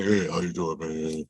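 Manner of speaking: lightly mimicked big-man mumble; a hint of a famous athlete's lazy greeting; Shaq. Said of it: "You know, that voice".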